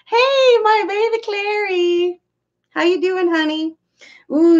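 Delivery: high cooing pet voice. Real, not acted. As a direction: coo at the little one. Greeting her pet.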